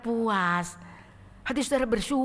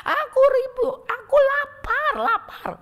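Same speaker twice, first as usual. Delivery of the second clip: much higher, louder slow cry of hunger; desperate longing; 10 dB louder, slower than usual. Acted. Maria longing for God's word, a high desperate cry. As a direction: plead as if starving for God's word. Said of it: "Biarinlah mau ngomong apa".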